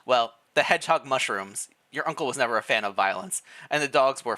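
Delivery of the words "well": ordinary delivery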